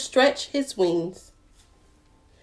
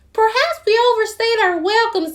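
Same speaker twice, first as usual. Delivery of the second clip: much higher, fast polite chirp; high quick chick; 12 semitones higher, 3 dB louder, faster than usual. Acted. The chick apologizes to the duck, high and quick. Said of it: "said Chick".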